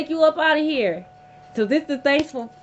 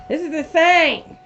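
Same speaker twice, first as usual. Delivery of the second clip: higher, slower and louder, stretching insane; louder disbelief; 4 semitones higher, 4 dB louder than usual. Real, not acted. Reader reacting to the card, louder and stretched out. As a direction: lean on insane with rising disbelief.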